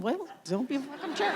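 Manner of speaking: high, laughing mock snap; laughing retort. Answering her uncle's complaint, laughing and high. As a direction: toss it off through a laugh. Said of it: "And I'm like".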